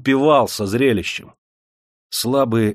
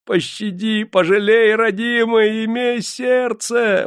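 очень высоко, громко, надрывно; вопят, умоляя о пощаде; вопль; 13 semitones higher, slower than usual. A narrator's reading. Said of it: "вопили калеки". (калеки вопят, моля заводчика о жалости)

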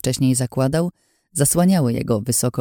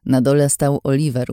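ordinary delivery

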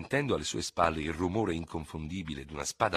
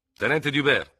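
raised, higher call of address; calling to a companion; 8 semitones higher, 8 dB louder than usual. A narrator's reading. Ferraud addresses his fellow officer outside the lodging, calling out. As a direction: call the officer by rank, firmly.

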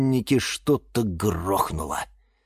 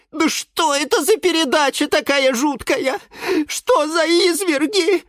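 very high, distraught squeal; Филимон crying out. A narrator's reading. Lemon seeing lemon juice squeezed, distraught cry. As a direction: wail in outrage, pitched right up. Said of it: "закричал Лимон Филимон".

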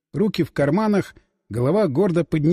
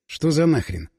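ordinary delivery, nothing marked

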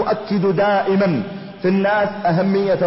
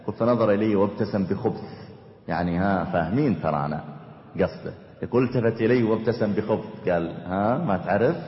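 hushed; 9 semitones lower, 6 dB quieter than usual. Real, not acted. Quiet retelling of shameful story.

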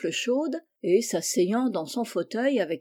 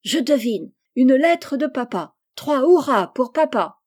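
higher, louder jubilant exclamation; excited cry; 4 semitones higher, 7 dB louder than usual. A narrator's reading. Joe guesses there is a letter from father, excited cry. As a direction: cry it out and build to a loud cheer. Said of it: "s'écria".

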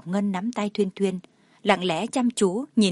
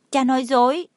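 put-on high little-boy voice, mildly tearful; acted childlike upset; tearful child; 5 semitones higher, 4 dB louder than usual. A narrator's reading. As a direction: accuse dad like a hurt child. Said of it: "Thuyên Thuyên dưng dưng nói".

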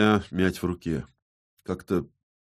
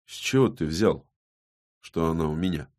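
understated, timid questioning; nervous babble; 2 semitones lower than usual. A narrator's reading. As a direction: stammer out a mild, nervous denial. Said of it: "пролепетал Виссарион".

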